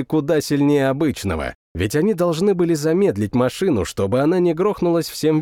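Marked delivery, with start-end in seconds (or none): none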